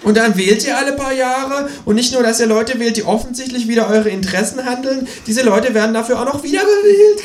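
very high, indignant croak; high-pitched ranting kangaroo. A narrator's reading. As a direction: croak out an outraged tirade. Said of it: "krächzt das Känguru".

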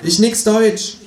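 high, broken-German foreigner; hammed-up foreign voice; fake foreign accent. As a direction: play dumb as a foreigner. Faking a foreigner.

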